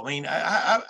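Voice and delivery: real stammer, stuck on one word; searching haltingly for how to begin. Real, not acted. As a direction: stumble over the start, repeating it with halting gaps. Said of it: "I'm stammering".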